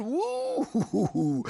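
a short delighted woo; impressed exclamation; excited whoop. Real, not acted. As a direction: whoop in admiration. Exclaiming about Hitchens' strong pen.

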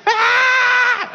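high, long mock-terror scream; hammed-up panicked shriek; dramatic scream. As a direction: shriek as if thrown into fire. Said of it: "I don't think they were doing that".

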